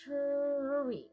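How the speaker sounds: TREE drawn out slowly on a held r; slow stretched word. Shown as a demonstration. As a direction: say TREE slowly, gliding from sound to sound. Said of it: "Nice and slow".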